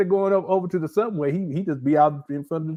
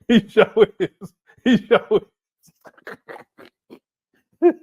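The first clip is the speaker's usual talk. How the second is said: ordinary delivery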